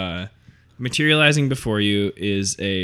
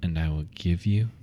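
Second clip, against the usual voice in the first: cold amused ghost; 4 semitones lower than usual. Acted. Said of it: "she smiles in cold amusement and says".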